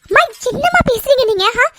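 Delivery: stunned that mother would ask this; shocked protest. Acted. Daughter protests to her mother, shocked and hurt.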